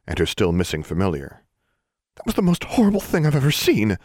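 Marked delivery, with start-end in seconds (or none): 2.2-4.0 high, excited outburst; exclaiming. A narrator's reading. Classmate exclaiming about the horrible scene. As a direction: gush it out, still shocked. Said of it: "Darla exclaimed".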